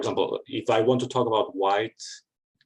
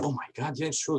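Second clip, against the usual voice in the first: higher, amazed student reaction; awestruck students; 7 semitones higher, 3 dB quieter than usual. Retold or imitated. Students watching the propaganda video, awed and higher. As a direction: play the students, won over and wide-eyed. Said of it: "they were like".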